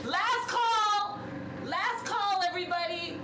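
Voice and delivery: calling out across the bar, high; last call shout. Real, not acted.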